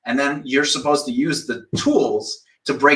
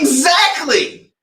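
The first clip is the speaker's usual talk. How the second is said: much higher, drawn-out, triumphant; emphatic exactly; 12 semitones higher, 3 dB louder, much slower than usual. Real, not acted. Pouncing on the student's admission, triumphant and drawn out. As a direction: pounce on the point.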